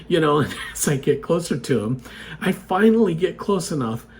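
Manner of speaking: normal talking voice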